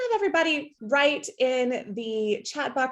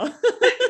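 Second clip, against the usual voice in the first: high giggle; 11 semitones higher, 6 dB louder than usual. Real, not acted. Laughs at compliment.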